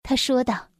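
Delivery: plain reading voice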